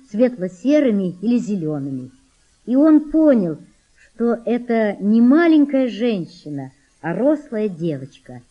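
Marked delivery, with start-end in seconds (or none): none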